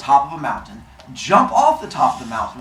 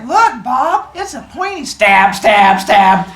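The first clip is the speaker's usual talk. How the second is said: gleeful brother; 8 semitones higher, 8 dB louder, slower than usual. Acted. Cyclops brother showing off spear.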